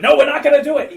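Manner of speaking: high, loud mock protest; hammed-up crook shouting down his partner; arguing. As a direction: burst out in a pretend squabble. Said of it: "They would argue".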